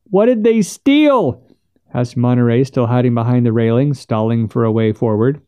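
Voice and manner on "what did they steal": high, slow, stretched question; calling out. A narrator's reading. Monterey asking from behind the railing, calling out. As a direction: call it out from cover, pitched up.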